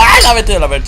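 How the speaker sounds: sudden high cry of fright; startled outburst. Real, not acted. Player jumped by the creature, startled outburst. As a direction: burst out cursing in alarm.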